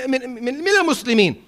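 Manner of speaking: normal talking voice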